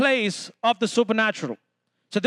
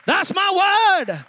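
higher, drawn-out cry to the crowd; emphatic exclamation; 6 semitones higher, 3 dB louder, much slower than usual. Real, not acted. Preacher repeating the declaration to the congregation, exclaiming. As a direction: belt it out slow and high.

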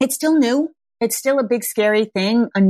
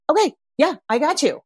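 soothing parent; 7 semitones higher than usual. Retold or imitated. Parent soothing crying baby.

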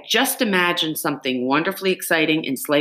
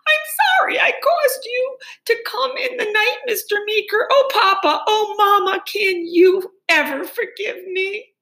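put-on baby shrieking cry. Girl shrieking apologies to Mister Meeker and her parents. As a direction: push the voice up into a baby's shriek. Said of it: "forced her voice higher and higher till it sounded like a baby".

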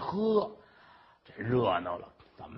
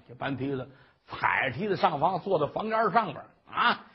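ordinary delivery, nothing marked

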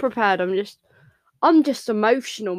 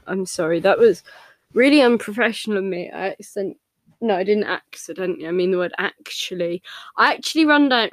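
usual speaking voice

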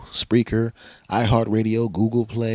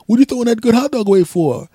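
high, fast put-on voice; mock neighbor; 14 semitones higher, 7 dB louder, faster than usual. The neighbor protesting the thrown hot dog, high mock voice. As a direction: squawk the question like the neighbor. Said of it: "But then he goes".

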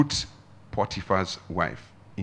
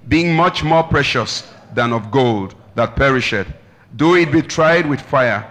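loud, measured scripture recitation; ringing declamation, easing at the end; leading group reading; 9 dB louder than usual. Real, not acted. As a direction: lead the congregation reading aloud together. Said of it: "let's read together. One, two, go".

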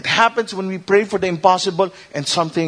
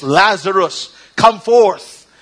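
commanding shout, much higher and louder; loud cry; 5 semitones higher, 7 dB louder, slower than usual. Acted. Jesus at the tomb calling Lazarus out, shouting. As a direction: call him out of the tomb. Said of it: "he cried with a loud voice".

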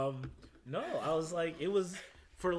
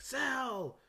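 high-pitched reunion cry, building louder; hearty mock greeting; 13 semitones higher, 6 dB louder, slower than usual. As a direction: imitate hailing a long-lost buddy, ending near laughter. Acting out guests greeting long-lost Sal.

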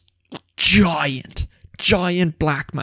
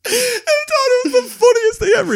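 squealing with laughter, very high; crying with laughter; laughing. Real, not acted. Joe laughing hard telling about the receipt.

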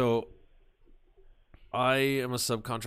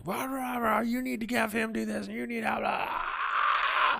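much higher shrill-pitched scolding rant; mock yelling woman; 14 semitones higher, 3 dB louder than usual. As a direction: imitate her nagging at you, pitched way up. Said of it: "She starts yelling at me".

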